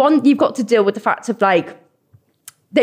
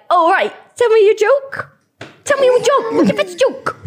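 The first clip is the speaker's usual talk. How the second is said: high-pitched pushy stranger, imitated; hammed-up heckling for a joke; mock demanding; 11 semitones higher than usual. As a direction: egg them on to perform, in a mocking high voice. Mimicking strangers demanding a joke from comedian.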